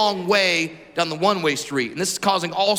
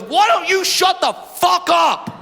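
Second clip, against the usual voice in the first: higher, slow, hostile snarl at the mom; angry driver; 8 semitones higher, 3 dB louder, slower than usual. Retold or imitated. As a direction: spit it out slowly like a rude stranger. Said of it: "this guy proceeds to look at my mother and goes".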